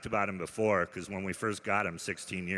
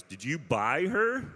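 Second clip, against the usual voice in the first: judgy question; 6 semitones higher, slower than usual. Retold or imitated. Judgy woman at dog park.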